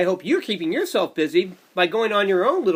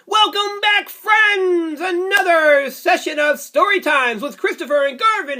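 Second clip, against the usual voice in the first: put-on high, loud cheerful call to the kids; shouted kids' show welcome; excited welcome; 10 semitones higher, 5 dB louder, slower than usual. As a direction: greet them like a kids' host, big and bright.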